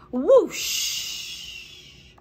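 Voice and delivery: breathy rushing whoosh; water whoosh. Acted. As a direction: gush like water from a hose. Water gushes from hose.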